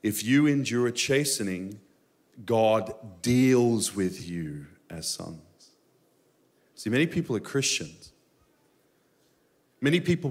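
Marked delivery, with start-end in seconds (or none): 0.0-5.4 very slow, dwelling on every word; slow reading. Shown as a demonstration. Pastor reading the verse slowly to the congregation. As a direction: read it slowly so it sinks in. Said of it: "We're gonna read this slowly".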